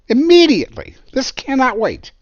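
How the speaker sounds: high, loud urgent order; urgent command. Retold or imitated. Inspector ordering the crew, urgent and loud. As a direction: bark it like it cannot wait.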